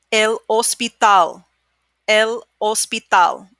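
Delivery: El Hospital said slowly, silent h, os-pi-TAL; Spanish pronunciation. Shown as a demonstration. Teacher modelling words for students to repeat, slow clear Spanish. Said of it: "repeat after me".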